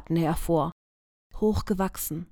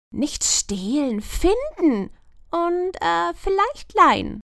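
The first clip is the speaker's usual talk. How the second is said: higher, slow and sly; wry grin; 10 semitones higher, slower than usual. A narrator's reading. Lumina correcting the magpie with a sly grin. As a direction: correct her with a crooked grin. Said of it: "mit einem schiefen Grinsen".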